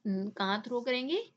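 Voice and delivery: ordinary delivery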